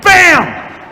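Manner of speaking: ordinary talk, nothing marked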